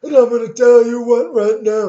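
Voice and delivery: wrestler-style Bernie. Acted. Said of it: "I lost Bernie".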